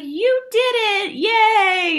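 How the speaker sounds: high, loud cheer. Retold or imitated. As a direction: cheer them on, high and bright. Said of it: "she'd cheer for you".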